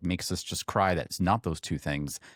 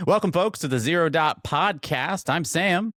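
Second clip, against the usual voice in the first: high, loud, bright-eyed show host welcome; big announcer intro; 10 semitones higher, 7 dB louder than usual. Acted. Host welcoming listeners to the podcast, booming announcer voice. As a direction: open the show like a hyped announcer.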